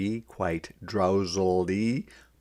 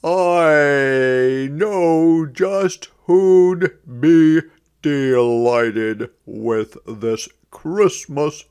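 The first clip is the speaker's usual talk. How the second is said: higher, louder, slow delighted character; eager storybook voice; 6 semitones higher, 8 dB louder, much slower than usual. A narrator's reading. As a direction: savor the words like a happy gift giver. Character line in read story.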